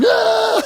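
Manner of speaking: high pig-like squeal. Retold or imitated. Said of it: "he starts squealing like a pig, going".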